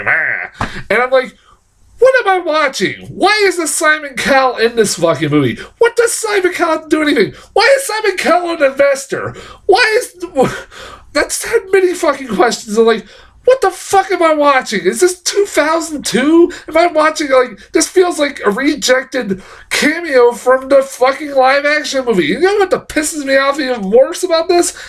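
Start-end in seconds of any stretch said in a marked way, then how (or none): 2.0-10.2 high-pitched, exasperated questions piling up; incredulous ranting. Retold or imitated. Reviewer retelling bafflement at Simon Cowell cameo, incredulous. 13.4-22.3 high-pitched exasperated disbelief; incredulous ranting. Retold or imitated. Reviewer ranting about the baffling movie, incredulous. Retelling own disbelief at the film.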